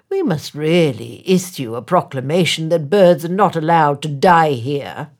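mayor indignant at a dead bird. A narrator's reading. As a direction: sound scandalised by the bird. Mayor proposes a proclamation.

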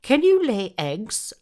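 high, loud clucking hen; bossy hen. Acted. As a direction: peck at him with the question, high and loud. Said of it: "asked the hen".

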